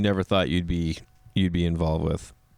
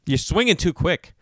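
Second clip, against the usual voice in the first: higher-pitched mock annoyed critique; imitated annoyance; 7 semitones higher than usual. Annoyed golf partner correcting the comedian's swing, mock annoyance. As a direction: needle him like an irked golf partner. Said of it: "he was getting so annoyed".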